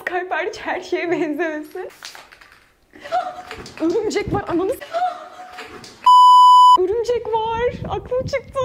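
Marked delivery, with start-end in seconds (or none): 3.0-3.8 startled gasp. Real, not acted. Spots a spider. 3.8-8.6 startled by spider. Real, not acted. Spots a big spider.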